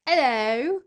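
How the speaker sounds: loud, high mock British greeting; exaggerated British-accent call. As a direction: belt it out as a hammed-up Brit. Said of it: "speak in a British accent".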